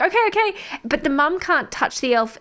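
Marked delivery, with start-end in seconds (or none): 0.0-0.5 laughing mum. Retold or imitated. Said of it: "It's like".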